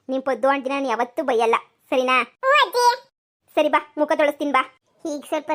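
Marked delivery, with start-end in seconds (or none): 2.4-2.9 put-on high, louder child's answer; little girl. Granddaughter answers grandma, high bright child voice. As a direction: chirp it like a small granddaughter.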